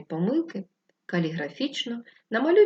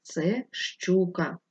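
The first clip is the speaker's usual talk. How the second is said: said slowly and clearly, spelling it out; slow answer reveal; much slower than usual. Shown as a demonstration. Teacher to class, slowly revealing the riddle answer. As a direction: draw out the answer so children catch it. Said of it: "Хто це, дітки?".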